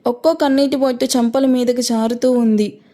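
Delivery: ordinary delivery, nothing marked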